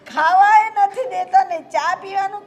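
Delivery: mother-in-law venting about not being fed; loud complaint. Acted. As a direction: burst out in grievance. Old woman complaining about daughters-in-law.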